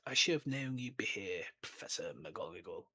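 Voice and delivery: put-on lightly hushed, knowing greeting to an old friend; soft film-style greeting. Dumbledore greeting McGonagall on the street, hushed. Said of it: "he whispers".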